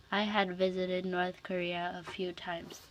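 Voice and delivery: ordinary delivery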